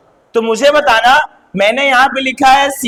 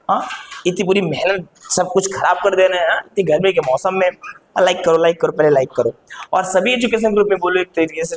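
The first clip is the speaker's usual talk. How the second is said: quieter, faster aside asking to subscribe; channel plug; 7 semitones lower, 4 dB quieter, faster than usual. Acted. Teacher breaks off to plead with viewers to subscribe. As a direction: coax viewers to like and share.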